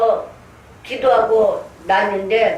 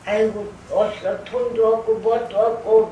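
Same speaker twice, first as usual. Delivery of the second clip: put-on whine of having nothing; grumbling imitation. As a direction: imitate someone moaning with a scowl. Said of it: "인상을 찌그리며 그런다고".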